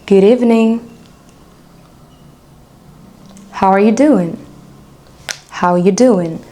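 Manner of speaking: each greeting said as one linked American phrase; pronunciation model. Shown as a demonstration. Teacher has learners repeat after her, pronunciation model. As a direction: model "What up", "Good morning" clearly, a phrase at a time. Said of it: "tu peux répéter après moi".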